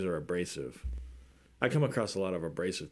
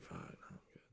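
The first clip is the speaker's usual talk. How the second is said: unvoiced, whispered refusal; whispered brush-off; 27 dB quieter, much faster than usual. Backing away from a fight, whispered. Retold avoidance of conflict.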